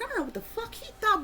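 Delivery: high, fast bewildered aside; baffled. Retold or imitated. Laughing about the dream man, a high, fast baffled aside. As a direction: rattle it off high in disbelief. Her own baffled reaction.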